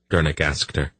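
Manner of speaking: usual speaking voice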